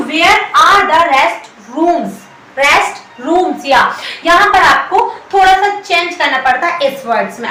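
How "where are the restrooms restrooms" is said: very slow, stretched; slow teaching demo. Shown as a demonstration. Teacher modelling the plural question, very slow. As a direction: model it slowly. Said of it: "तो".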